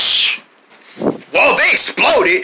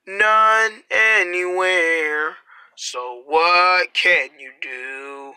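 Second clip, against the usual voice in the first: hushed, drawn-out commercial voice; much slower than usual. Acted. Reads a slow commercial.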